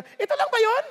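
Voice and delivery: high, loud let-down question; disappointed tourist. Retold or imitated. American tourist at Statue of Liberty, mock disappointed. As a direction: echo the underwhelmed American. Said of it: "kaya sabi ng Amerikano".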